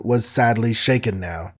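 plain reading voice

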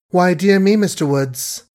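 airy mocking retort; careless retort. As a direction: toss it off carelessly. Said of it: "she retorted carelessly".

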